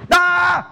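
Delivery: sharp high startled "ah"; a quick high yelp of alarm; startled cry. Shown as a demonstration. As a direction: jump at a sudden shock. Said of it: "That's reaction".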